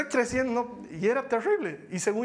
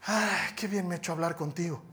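imitating a relieved counselee; 3 semitones lower than usual. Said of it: "termina y me dice".

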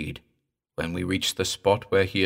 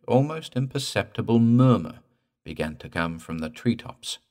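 ordinary delivery, nothing marked

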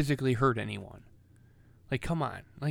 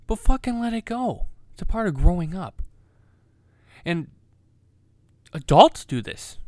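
loud, heated rant; really laying into it; angry rant; 3 semitones higher, 9 dB louder than usual. Real, not acted. Ranting about cancel culture.

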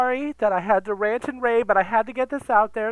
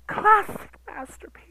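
awed whisper; 9 semitones higher than usual. Acted. Whispered reverent phrase.

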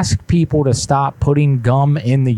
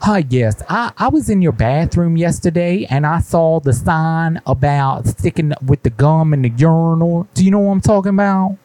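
higher, indignant put-on caller voice; prank caller voice; 4 semitones higher than usual. Prank caller to the gas station, put-on higher indignant voice. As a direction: play a fussy customer who got hung up on.

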